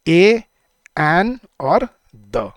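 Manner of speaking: ए, एन और the spelled out slowly, each word apart; slow listing. Shown as a demonstration. Teacher counting the three articles slowly for the class. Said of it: "कौन से कौन से होते हैं".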